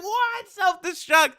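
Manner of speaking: very high shocked squeal; King Kai hearing about the bomb, shocked squeal. Retold or imitated. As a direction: shriek the realisation in disbelief. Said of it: "King Kai's like".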